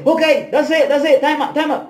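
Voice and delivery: high-pitched, repeated calls to stop; retold referee call. Captain stopping the fight after seven seconds, calling out.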